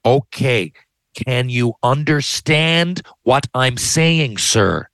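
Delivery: slow, drawn-out words to a foreign agent; talking slowly. Retold or imitated. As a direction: spell it out slowly like they can't follow. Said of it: "talk to somebody slowly".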